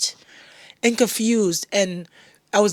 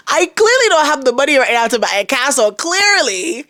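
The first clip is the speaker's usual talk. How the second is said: sarcastic retort; 6 semitones higher, 5 dB louder than usual. Retold or imitated. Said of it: "I'm like".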